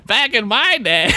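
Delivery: high-pitched mock old-timer; Samuel Clemens. Clemens barging into the podcast, high mock old-timer voice. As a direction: start an old codger's comic reminiscence. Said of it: "Samuel Clemens".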